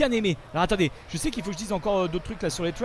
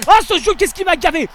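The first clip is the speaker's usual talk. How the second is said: venting; 11 semitones higher, 12 dB louder than usual. Real, not acted. Venting anger at the game.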